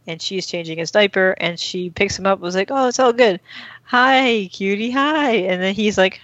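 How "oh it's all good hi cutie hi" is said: higher, lightly acted baby-talk greeting; cooing to baby. Rachel picking up the baby after changing him, cooing. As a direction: imitate someone fussing sweetly over a baby.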